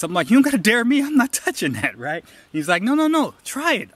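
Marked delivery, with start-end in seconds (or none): 0.3-2.0 high-pitched mock protest; adamant refusal. Answering a buddy's dare to eat raw fish, adamantly refusing. 2.8-3.9 high-pitched coaxing buddy; insistent urging. Retold or imitated. Buddy pressing him to taste the ahi, insistent.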